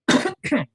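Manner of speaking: sudden high cough on a drink; sharp choking cough. Real, not acted. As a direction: cough as the drink goes down wrong. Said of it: "I'm choking on Strongbow".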